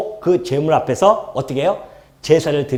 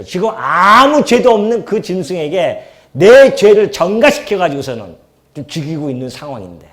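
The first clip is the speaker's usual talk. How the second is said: ordinary delivery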